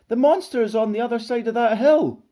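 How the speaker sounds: high, loud squeaky cry; squeaking. A narrator's reading. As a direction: squeak out an urgent warning. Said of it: "squeaked Basil suddenly".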